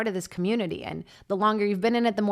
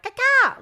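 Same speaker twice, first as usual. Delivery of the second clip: sudden squawk; 9 dB louder than usual. Pretend. Imagined weird night outburst.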